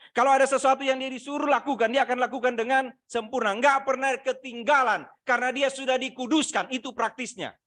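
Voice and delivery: loud, high, fervent sermon delivery; full-force preaching. Acted. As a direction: preach it out to the whole church.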